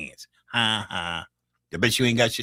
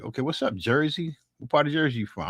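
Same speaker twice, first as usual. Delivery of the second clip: normal talking voice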